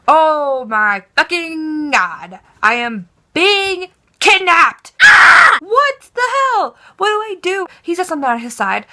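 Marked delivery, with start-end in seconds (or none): 0.1-4.9 loud, drawn-out panicked wail, building higher; acted panic. Passenger realizing she is being kidnapped, acted panic. 5.0-5.6 short, piercing high scream; loud shriek of terror, played up; panicked scream. Passenger screaming at being kidnapped, acted terror. 5.6-7.7 high-pitched frantic wail; acted panic. Passenger at a loss in a stranger's car, acted panic. Acting out panic at being kidnapped.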